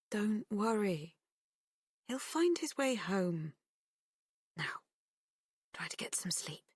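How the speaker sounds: soothing. A narrator's reading. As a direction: lull her gently to sleep. Mother soothing daughter.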